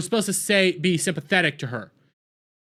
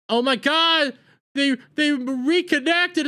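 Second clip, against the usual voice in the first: high, drawn-out mock emotion; mock overwhelmed; 11 semitones higher, slower than usual. Reviewer acting out the tearful reunion, mock overwhelmed. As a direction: gush over the reunion in a high voice.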